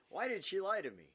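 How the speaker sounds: loud, high anguished cry; dramatic outcry. Acted. Reader reclaiming his line with a loud anguished cry. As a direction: hurl the question out loud. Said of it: "Hey, that's my line".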